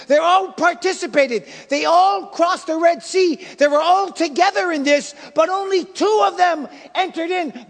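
high, loud, rising preacher's fervour; preaching at full force. Acted. Preacher hammering his church with the warning, fervently. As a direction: pound the point home to the congregation. Preacher drives home the main point.

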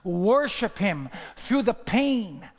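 loud, slow, rising sermon climax; preaching at full force. Acted. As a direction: drive it home to the congregation.

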